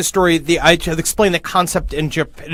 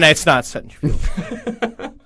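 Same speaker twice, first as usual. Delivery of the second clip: ordinary talk, nothing marked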